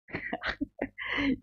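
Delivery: airy unvoiced chuckle; a quick breathy laugh. Real, not acted. Laughs at viewer's wizard comment.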